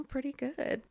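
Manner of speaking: normal talking voice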